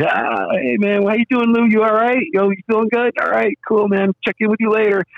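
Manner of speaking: high, fast-talking mock bro voice, checking in; chummy fan. Impersonating a fan checking in on security Lou, high bro voice. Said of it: "and like".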